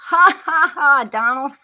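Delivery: loud, high, put-on laugh aimed at Donald; mocking laugh. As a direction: cackle at him to show he can't get to you. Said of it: "Choose to laugh".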